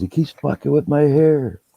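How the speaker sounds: high, put-on tattling voice; whiny complaint. Groom complaining to the cameraman, in a high mock whine.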